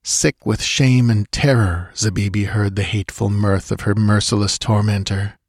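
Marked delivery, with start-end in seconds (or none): none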